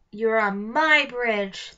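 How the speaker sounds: slow, loud troll bellow; angry troll shouting at the twins on his bridge. Acted. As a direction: roar it out slowly like an angry troll. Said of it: "the troll shouted".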